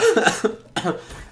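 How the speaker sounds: dry cough from a cold; real cough. Real, not acted. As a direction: cough mid-sentence, sick. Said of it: "désolé si je tousse".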